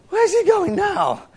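high, slow puzzled voice; bewildered rider. Retold or imitated. Jesus's passenger calling from the Harley, high and puzzled. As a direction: squeal it out, baffled on the back of the bike. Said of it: "he's like".

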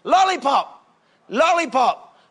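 high, punchy repeated demand; imitation of a child who won't let up; nagging young son. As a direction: pester relentlessly as a little boy, each one popping up in pitch. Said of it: "It keeps going on and on".

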